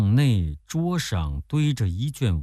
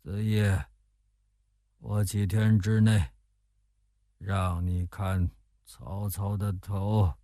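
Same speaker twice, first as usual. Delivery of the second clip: mumbled sleep-talk; 4 dB quieter than usual. A narrator's reading. General feigning sleep beside his guest, vague mumbling. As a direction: mumble as if dreaming. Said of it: "周瑜嘴里含糊地说着".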